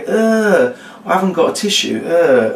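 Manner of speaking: high, groaned disgust; mock disgust. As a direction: whine with exaggerated revulsion. Acts disgust at wiped finger.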